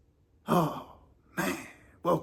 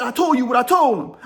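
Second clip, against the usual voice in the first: loud, higher snapping retort; defiant shout; 8 semitones higher, 5 dB louder than usual. Retold or imitated. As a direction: throw it back at him hard. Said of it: "He said".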